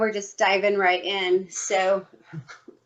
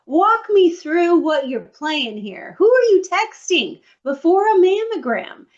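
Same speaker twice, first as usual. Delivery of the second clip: confrontational demand; 6 semitones higher, 4 dB louder than usual. Retold or imitated. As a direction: call her out on the texting.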